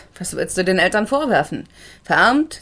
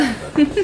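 short laugh; 7 semitones higher than usual. Acted. Journalist congratulating the lawyer.

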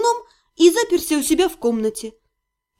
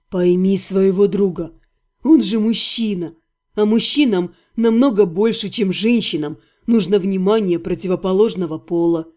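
put-on calm old healer; 7 semitones lower than usual. A narrator's reading. Healer calming the heroine, low and patient. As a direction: soothe her gently.

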